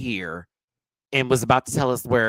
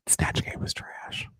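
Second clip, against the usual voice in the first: slow, deliberate whisper; whispered verdict; 7 dB quieter, slower than usual. Real, not acted. Reviewer passing judgment on Snatch Game, whispered. Hushed verdict after long silence.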